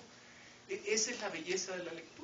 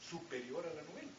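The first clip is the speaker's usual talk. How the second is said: normal talking voice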